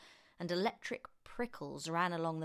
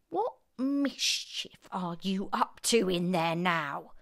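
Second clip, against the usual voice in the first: mild granny screech; slower than usual. Acted. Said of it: "Granny screeched".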